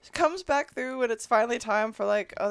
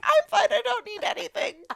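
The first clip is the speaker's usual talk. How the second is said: defensive mock refusal; 9 semitones higher than usual. Passenger ignored by stewardess, high defensive insistence.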